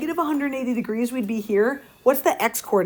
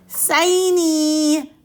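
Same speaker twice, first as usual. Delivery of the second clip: high, drawn-out whine on siny; whiny voice; 5 semitones higher than usual. Shown as a demonstration. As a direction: whine the word like a kid. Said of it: "you gotta do it in the whiny voice".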